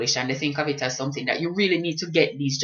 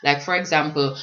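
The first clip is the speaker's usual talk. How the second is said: ordinary talk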